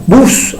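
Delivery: "bus" alone, high, stressed on u; stressed syllable alone. Shown as a demonstration. Teacher pointing out the accent on U, high stressed "bus". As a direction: pitch up and lean on the u of "bus". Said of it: "ahí está el acento en la U".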